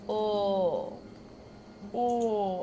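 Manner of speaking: drawn-out Minnesota "Oh" with a long rounded vowel; imitating a friend's Minnesota "Oh"; mock Minnesota oh. Mocking Jace's Minnesota accent, a hammed-up Minnesota "Oh". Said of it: "he's like".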